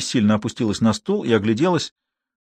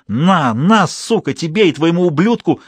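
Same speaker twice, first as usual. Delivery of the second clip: higher, louder furious curses; frenzied rage; 6 semitones higher, 3 dB louder, slower than usual. A narrator's reading. Crazed mother-in-law stabbing her victim, raging. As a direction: spit it out in a frenzy. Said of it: "неистовала потерявшая рассудок свекровь".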